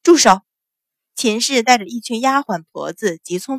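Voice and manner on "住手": sharp, loud command; commanding shout over a brawl. A narrator's reading. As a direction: bellow an order to halt. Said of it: "只听一声大喝".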